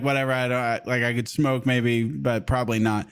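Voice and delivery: low, unbothered mumble of a shrug; indifferent. Retold or imitated. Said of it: "he seems indifferent".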